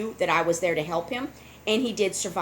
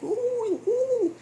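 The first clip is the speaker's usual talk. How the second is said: imitated fox coos; slower than usual. The fox in the back seat making little coo sounds, imitated high. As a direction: coo like a small frightened fox. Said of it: "little coo sounds".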